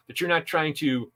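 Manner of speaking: ordinary talk, nothing marked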